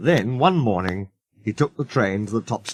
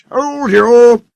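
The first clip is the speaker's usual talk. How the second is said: very high, loud gloating crow; a naughty engine gloating at his driver; triumphantly; 13 semitones higher, 10 dB louder than usual. A narrator's reading. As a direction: crow with smug glee. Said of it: "said Sir Handel triumphantly".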